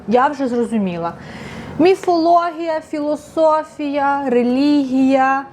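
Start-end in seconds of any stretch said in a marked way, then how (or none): 1.8-5.5 high, very slow, stretched words; drawn-out listing. Real, not acted. Vlogger lists a book's themes to viewers, drawn-out high listing. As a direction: stretch each subject out, held up high.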